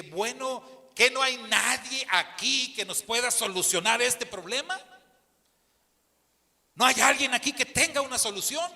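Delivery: loud, high call to the whole store; projecting like a preacher demanding help. Retold or imitated. Pastor shouting across the checkout line for help, loud. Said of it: "Entonces levanté la voz".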